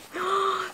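a sharp high gasp of delight. Real, not acted. Unboxing a gift bag, a delighted gasp.

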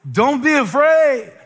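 high, slow, mock-pious "Don't be afraid"; mocking cliché. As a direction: sing out the cliché in a sugary high voice. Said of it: "easy to quote scriptures like".

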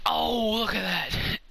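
high, drawn-out amazed cry; slow, high-pitched thrill at the play; excited exclamation. Real, not acted. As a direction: draw out the oh in amazement. Reacting to a play.

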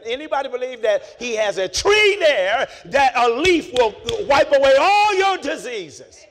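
loud, high preaching cry; preaching shout. Acted. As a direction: shout it out to the congregation. Preaching at full force.

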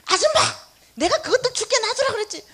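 loud high scolding yell; gruff shout. Retold or imitated. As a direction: snap at her like a cranky vendor. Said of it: "그 아저씨 이러고 있어".

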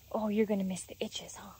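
soft, tender whisper. Real, not acted. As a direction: whisper fondly to a horse. Whispering to horse.

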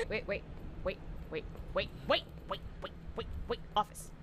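low, soft, urgent repeats; hushed frantic waits. Real, not acted. Streamer hiding from a monster, hushed urgent waits. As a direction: whisper it to stay hidden.

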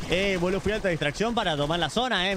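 usual speaking voice

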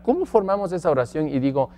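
usual speaking voice

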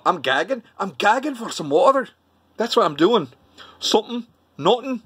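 mock Mancunian, rising high in places; Manchester accent. Shown as a demonstration. Vlogger showing how Manchester talks, mock Mancunian accent. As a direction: put on a lilting Manchester accent. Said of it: "how about Manchester".

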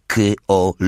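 K-O-L-O-N-I-I said letter by letter; K-O-L-O-N-I-I as distinct Polish letter sounds; spelled out. A narrator's reading. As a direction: spell K-O-L-O-N-I-I out, each letter separate. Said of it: "przeliterował".

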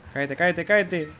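con tono algo más agudo; provocador. Real, not acted. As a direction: pincha para que se caiga. Provoca la caída del personaje. (provocador, repetitivo)

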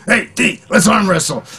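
high-pitched, eager call; mock rowdy challenge. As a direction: imitate a pal pestering for a match. Acts Jeffrey's late-night arm-wrestling challenge.

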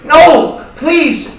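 high, very loud wailed plea; anguished begging. Acted. Evicted roommate's final plea as other leaves, anguished. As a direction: scream out a last desperate appeal.

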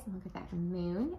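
usual speaking voice

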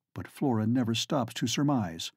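usual speaking voice

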